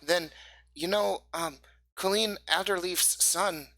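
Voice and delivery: put-on high, loud, excited kid voice; high-pitched child passing on a secret; eager young boy Pip. Game master plays Pip, a boy.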